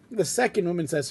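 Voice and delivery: ordinary delivery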